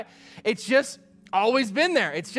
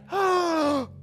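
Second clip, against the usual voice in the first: mock gasp; 7 semitones higher than usual. Mock shock at the complaint.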